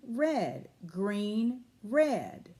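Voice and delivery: usual speaking voice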